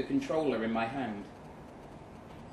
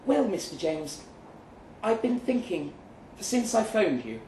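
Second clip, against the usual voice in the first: higher woman's voice, a bit louder; lightly voiced woman making her point; Kate, decided; 6 semitones higher, 3 dB louder than usual. A narrator's reading. Kate explains herself.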